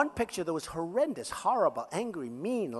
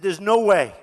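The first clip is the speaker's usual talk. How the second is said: firm refusal; 12 dB louder than usual. Retold or imitated. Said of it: "I said".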